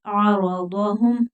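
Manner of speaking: आरादहुम slowly, heavy r, ain from the throat; आरादहुम at a careful teaching pace; model pronunciation. Shown as a demonstration. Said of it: "आ नहीं पढ़ेंगे".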